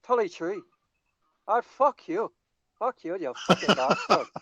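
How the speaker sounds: mock Irish accent, cursing; hammed-up Irish accent, swearing at a mate. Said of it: "I could do an Irish accent".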